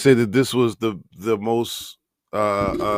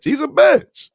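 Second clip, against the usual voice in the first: mocking high jab; 5 dB louder, slower than usual. Real, not acted. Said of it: "I got pet names for everybody".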